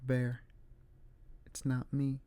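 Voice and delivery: soft, hushed plea; barely above a breath, pleading; talking very quietly. Retold or imitated. Trapped girl quietly pleading with Bear in bed. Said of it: "she's talking very quietly".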